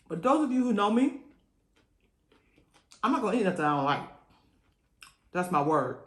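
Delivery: ordinary talk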